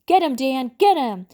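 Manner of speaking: narrator's contained version of screaming at the top of the voice; mock scream. A narrator's reading. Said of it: "started screaming at the top of my voice".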